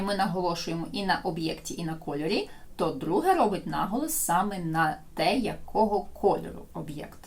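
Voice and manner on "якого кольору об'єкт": ordinary talk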